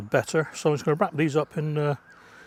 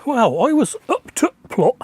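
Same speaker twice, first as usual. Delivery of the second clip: put-on Yorkshire accent, higher than usual; broad Yorkshire accent, played up; best Yorkshire accent; 9 semitones higher, 5 dB louder than usual. Shown as a demonstration. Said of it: "in my best Yorkshire accent".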